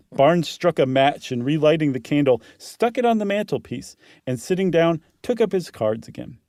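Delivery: ordinary delivery, nothing marked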